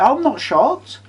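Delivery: high-pitched incredulous retort; retold disbelief. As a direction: scoff it up high. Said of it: "I went".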